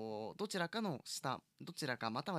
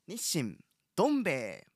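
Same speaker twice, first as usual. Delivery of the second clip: announcing the product; 3 semitones higher, 10 dB louder than usual. Real, not acted.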